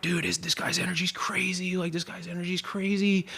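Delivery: high, soft awed stoner voice; awed stranger. Retold or imitated. Festival stranger marvels at the narrator, high and hushed. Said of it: "And they're like".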